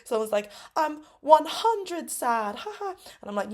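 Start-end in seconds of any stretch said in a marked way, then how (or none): 0.8-2.5 put-on high, loud kid one-upping the joke; happy and excited. Pupil outdoing a classmate's answer, gleeful and high. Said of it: "happy and excited". 2.5-3.0 very high, soft mock laugh; mimicked giggle. Pupil laughing at own joke, high giggle.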